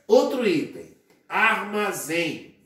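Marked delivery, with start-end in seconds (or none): 1.3-2.6 "armazém" drawn out, the final "zém" held; slow stressed syllable. Shown as a demonstration. Teacher to class, slowly stressing "zém" in "armazém".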